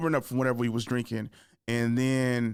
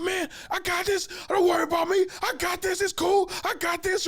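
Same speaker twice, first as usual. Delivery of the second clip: high-pitched shouted mock reassurance; worked-up insistence. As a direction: wave off advice in a frantic high voice. Acts out stressed driver insisting.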